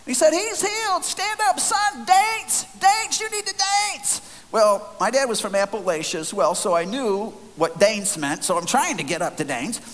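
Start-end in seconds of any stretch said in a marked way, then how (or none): 0.3-4.2 very high hollered commands; screamed proclamation. Retold or imitated. Healer ordering the man to dance, screaming. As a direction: holler it like a fired-up faith healer. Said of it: "screaming, yelling".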